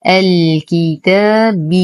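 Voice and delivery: الكتاب with the ب given a clear ِ, slowly; slow recitation. Shown as a demonstration. Teacher stresses the kasra on ب, slow recitation. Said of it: "بي وليس ب".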